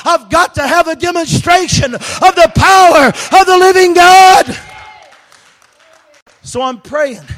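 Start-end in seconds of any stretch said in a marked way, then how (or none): 0.1-4.6 building from strong preaching to a full shout, much higher and louder; fervent preaching. Acted. Preacher rousing his congregation, building to a roaring shout. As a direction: preach it like fire from the pulpit.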